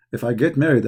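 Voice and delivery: ordinary talk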